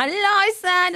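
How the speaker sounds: high, loud chirpy greeting; cheery hello. Retold or imitated. MC arriving at work, loud and chirpy. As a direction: sing out a bright hello. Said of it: "så kommer jeg sånn".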